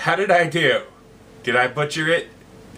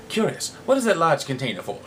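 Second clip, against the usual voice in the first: normal talking voice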